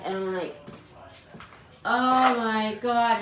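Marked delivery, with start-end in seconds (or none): 1.8-3.2 very slow, drawn-out retold dismay; dramatic, long-stretched disbelief; freaking out. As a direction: drag out every word in mock horror. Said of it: "I was freaking out".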